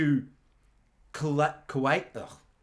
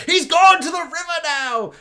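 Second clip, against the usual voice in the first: much higher and much louder yell; panicked shout; 12 semitones higher, 8 dB louder than usual. Retold or imitated. Dad yelling as the mule floats down the river. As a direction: cry out as the mule washes away.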